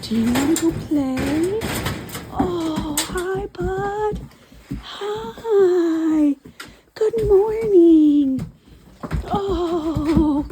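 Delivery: puppy talk. Real, not acted. Greeting the puppy lovingly.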